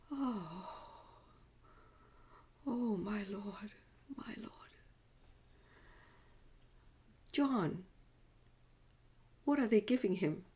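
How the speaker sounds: soft, faint lament trailing almost to breath; grieving mother, hushed. Acted. Mother watches her son bleed on the cross, hushed grief. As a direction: grieve quietly, barely able to speak.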